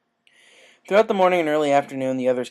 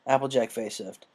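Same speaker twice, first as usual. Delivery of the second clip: usual speaking voice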